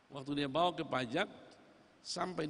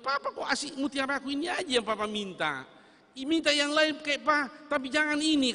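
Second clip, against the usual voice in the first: protesting daughter; 9 semitones higher, 6 dB louder than usual. Acted.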